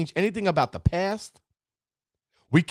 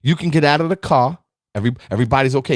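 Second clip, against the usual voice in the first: normal talking voice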